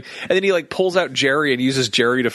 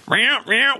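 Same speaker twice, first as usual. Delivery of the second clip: odd cartoon cat meow; 10 semitones higher than usual. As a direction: meow pitched up high, a weird take on a cartoon cat. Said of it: "But he's like".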